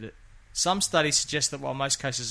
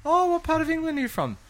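much higher, louder nosy questioner; mock curious stranger; 7 dB louder than usual. Imagining people quizzing a foreign-sounding Australian, high mock voice. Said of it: "People are like".

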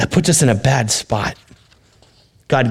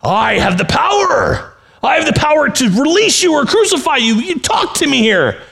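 puffed-up Pilate. Acted. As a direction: swell with self-importance. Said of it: "He kind of puffs himself up".

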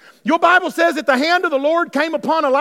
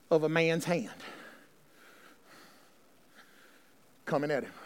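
hushed sermon storytelling; 8 semitones lower, 5 dB quieter than usual. Acted. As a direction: draw them in with a near-whisper.

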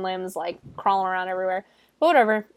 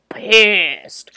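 "pissed" stretched out long and louder; drawn-out PISSED; 9 dB louder than usual. Retold or imitated. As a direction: lean hard and long on "pissed". Stressing how angry Maddie is.

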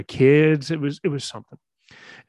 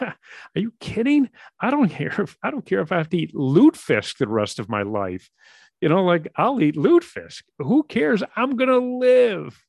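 much higher and louder, bursting with joy; elated; 9 semitones higher, 5 dB louder than usual. Retold or imitated. Husband telling his worried wife he doesn't care, just thrilled to live. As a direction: blurt it out overjoyed.

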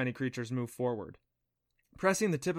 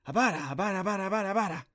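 high-pitched little creature chant; cute creature babble; purring creatures; 7 semitones higher, much faster than usual. A narrator's reading. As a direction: chatter the word rapidly up high, like tiny creatures. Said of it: "they purred".

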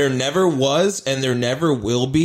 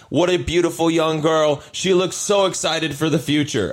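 mock-gushing praise, pitched up; sarcastic; 4 semitones higher than usual. President's comment trolling a young activist, sarcastic imitation. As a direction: gush insincerely, imitating a troll. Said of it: "so sarcastic".

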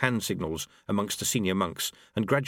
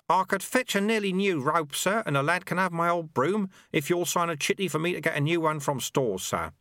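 put-on high, eager old servant voice; keen little monk offering help; eager helpful sweeper; 9 semitones higher than usual. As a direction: rush to be helpful, sir-ing everyone. Lutze pleads eagerly.

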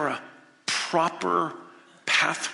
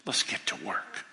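lower, soft, kindly voice; gentle Father; 4 semitones lower, 7 dB quieter than usual. Acted. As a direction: reassure like a loving father.

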